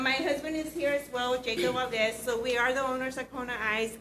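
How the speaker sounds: ordinary talk, nothing marked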